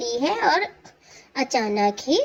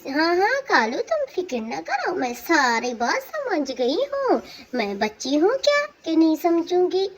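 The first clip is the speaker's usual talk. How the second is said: put-on higher, impatient young wife voice; higher dismissive bride; 6 semitones higher than usual. New wife waving off her husband's advice, higher and sassy. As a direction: brush him off with a high, sassy tone.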